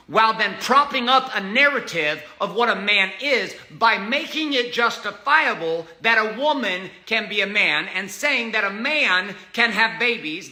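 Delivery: ordinary talk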